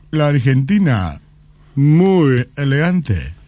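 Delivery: very slow, savouring verdict; pause, then drag out the praise; slow Japanese man. Acted. Japanese lover judging women for the interviewer, very slow.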